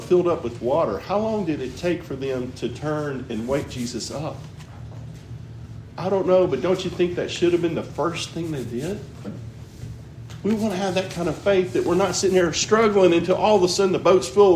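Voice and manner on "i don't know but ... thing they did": usual speaking voice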